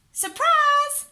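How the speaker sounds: usual speaking voice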